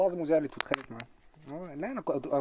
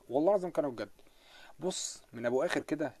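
ordinary talk

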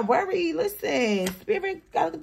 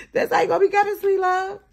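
laughing; 7 semitones higher, faster than usual. Real, not acted. Laughing after the joke.

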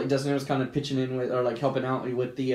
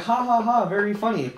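sarcastic; 6 semitones higher than usual. Retold or imitated. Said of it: "I was like".

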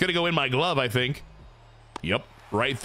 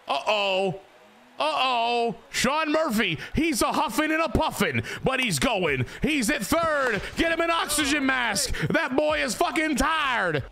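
high, shouted running commentary; excited play-by-play; 11 semitones higher than usual. Real, not acted. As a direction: hype the lumbering runner, pitch way up. Calls runner racing round the bases.

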